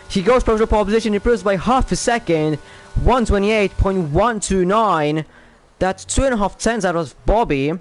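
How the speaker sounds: fast, rising pole call; excited commentary. Real, not acted. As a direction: call the pole lap with a burst. Driver takes provisional pole.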